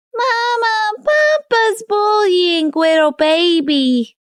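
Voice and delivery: put-on high, slow sing-song baby sob; wailing toddler. A narrator's reading. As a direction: bawl it like a tearful toddler. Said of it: "He burst into tears as he wailed".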